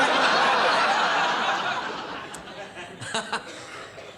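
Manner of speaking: long, high, unvoiced cackle; long wheezy laugh. Real, not acted. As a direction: collapse into a breathy laugh at your own gag. Laughing at own joke.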